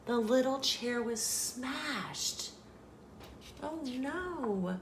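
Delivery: high, soft, slow dismay; hushed dismay. A narrator's reading. Goldilocks has smashed the little chair, hushed dismay.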